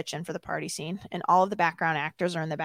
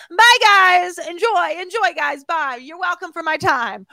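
high, singsong showy goodbye; hammed-up, gracious send-off; self-important farewell; 9 semitones higher, 6 dB louder than usual. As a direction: call goodbye like a star leaving fans. Mocking her own grand exit.

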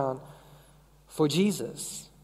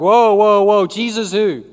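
louder, backing off fast; loud startled denial; 6 dB louder than usual. Retold or imitated. Peter loudly pretending not to know Jesus. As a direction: throw up your hands in mock alarm. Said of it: "Now he's like".

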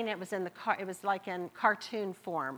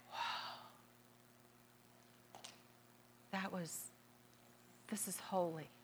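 very quiet, lower, lingering pauses between phrases; hushed awe; 3 semitones lower, 13 dB quieter, slower than usual. Acted. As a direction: breathe out a soft, low wow of reverence. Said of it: "things that have awed us".

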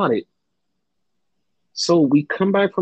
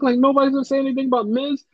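higher and fast, rising in disbelief; exasperated; 9 semitones higher, much faster than usual. Real, not acted. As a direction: rattle it off in outraged disbelief. Restates complaint, exasperated and rushed.

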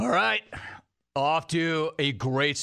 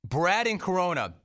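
usual speaking voice

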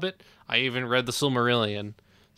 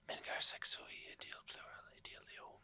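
whispered Elvish; 19 dB quieter than usual. Pretend. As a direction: breathe the Elvish like a seductive elf. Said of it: "what was that voice".

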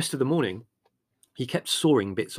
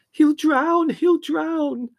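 very high, loud panicked shriek; screaming; 9 dB louder, much slower than usual. A narrator's reading. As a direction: scream in alarm as someone goes under. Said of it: "screamed Margot".

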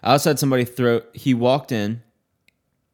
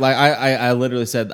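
ordinary delivery

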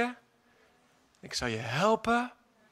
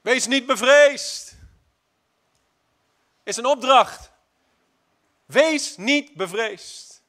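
loud, slow, higher; commanding proclamation; 5 semitones higher, 9 dB louder, much slower than usual. Acted. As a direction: order it to the congregation.

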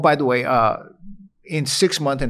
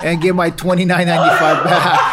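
louder and louder through laughter; laughing mock threat; 2 semitones higher, 7 dB louder than usual. Widower retelling his joke to his wife, laughing loud. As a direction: rant it while cracking up. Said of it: "the first thing I told Michelle was like".

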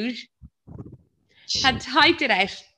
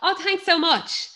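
sarcastic mock thanks; 6 semitones higher than usual.

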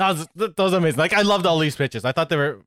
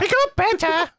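high shrill-free Python lady voice; Monty Python woman; 14 semitones higher than usual. Pretend. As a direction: squeak it like a Python housewife. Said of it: "a Monty Python woman".

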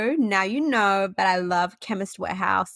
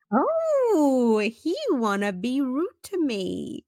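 high drawn-out "Oh", then slow indignation; affronted reaction; 5 semitones higher, much slower than usual. Retold or imitated. Patient reacting to the doctor's brush-off, affronted. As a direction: stretch it out in mock offence.

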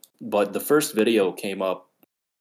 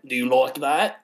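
lightly done cartoon Brit; touch of hammed-up accent; mock woman's voice; 3 semitones higher than usual. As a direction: imitate the oddball-voiced woman. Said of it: "she's like".

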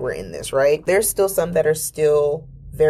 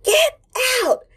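piercing, much higher scream; shrieking, near-crying child yell; toddler scream. Retold or imitated. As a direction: scream it like a tiny kid at the end of her rope. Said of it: "And I'm like".

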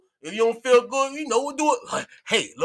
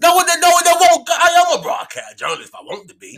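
higher and louder, protesting; huffy outburst at the teasing; indignant; 9 semitones higher, 7 dB louder than usual. Real, not acted. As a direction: defend yourself, offended and loud. Indignant protest at being mocked.

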